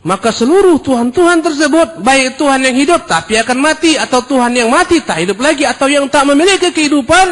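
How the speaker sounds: loud, high rising sermon cry; fiery preaching. Acted. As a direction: build it like a sermon climax. Preacher shouting the list of gods. (seruan khotbah keras dan tinggi)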